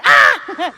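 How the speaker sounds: short, high "ah!"; mock shocked cry. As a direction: cry out as if just discovering it. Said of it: "and you'll be like".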